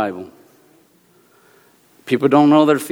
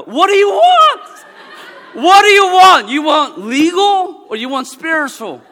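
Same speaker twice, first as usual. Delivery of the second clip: high, loud mock exasperation, laughing; exasperated retort; 13 semitones higher, 6 dB louder than usual. Retelling his exam, mock-exasperated at the committee, high and loud. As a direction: throw up your hands at the examiners. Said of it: "I go".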